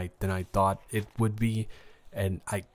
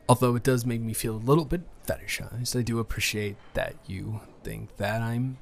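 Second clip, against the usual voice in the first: ordinary delivery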